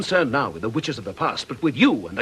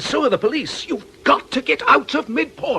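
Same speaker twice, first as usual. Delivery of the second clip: urgent plea; 12 semitones higher than usual. Acted. Nick urging Aggie to flee.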